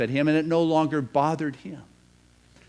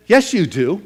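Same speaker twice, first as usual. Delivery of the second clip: firm contradiction; 4 semitones higher, 12 dB louder than usual. Acted. Wise man contradicts the prince.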